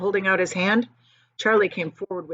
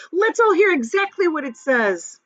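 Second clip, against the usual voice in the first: eager; 10 semitones higher, 7 dB louder than usual. Acted. Grandpa Joe eager.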